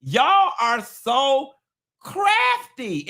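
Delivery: "crafty" shot up high after a slow "Y'all are so"; slow, building, "crafty" very high; stretched emphasis. Real, not acted. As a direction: draw it out, then pop "crafty" high.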